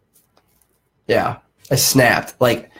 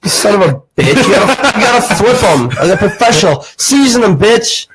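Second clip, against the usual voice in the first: much higher, laughing put-on chef voice; hammed-up chef; 9 semitones higher than usual. Chef scolding a student cook, much higher laughing mock rant. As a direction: yell it like a cartoon kitchen boss.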